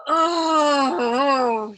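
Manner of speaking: a loud, crying moan everyone can hear. A narrator's reading. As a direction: let the moan ring out loudly across the room. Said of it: "Yossarian moaned a fourth time".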